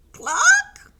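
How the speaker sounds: very high, loud questioning cluck; startled hen noise as a question; surprised cluck. Pretend. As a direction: squawk a rising, astonished cluck. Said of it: "in surprise".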